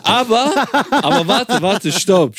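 laughing. Real, not acted. Breaks off laughing, stops talk.

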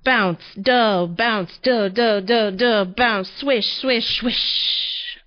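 bouncy, rhythmic tumbling noises; sound effects. A narrator's reading. Narrator acting out a tumble down snow, rhythmic noises. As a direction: bounce along, then let the swishes hiss out.

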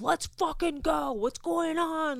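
high, loud, drawn-out angry demand; imitating angry freak-out. Pissed-off guy on set at laughing co-stars, imitated angry outburst. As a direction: mimic a man losing his temper. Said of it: "Like, freaking out".

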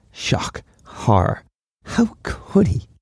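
hammed-up scandalised gasp, the last words high and slow; mock horror. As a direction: pretend to be shocked, tongue in cheek. Mock outrage at trivial insult.

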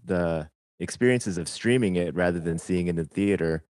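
normal talking voice

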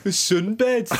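high mock Aussie accent; Australian accent. Co-host teasing with the word, hammed-up Australian accent. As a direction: pitch it up in exaggerated Australian.

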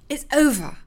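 cold angry snap; snapped. Acted. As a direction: bite off the words in anger. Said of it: "Kizzy snapped".

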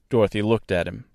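ordinary delivery, nothing marked

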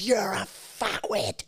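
high, strained mock yell; slow, high-pitched retold insult; imitating angry screamer. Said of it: "he's screaming at me".

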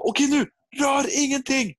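high-pitched, strained yell; re-enacted panicky shout to the roof; urgent shouted order. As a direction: freeze everyone with a holler. Shouts once picture finally appears.

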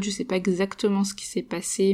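ordinary delivery

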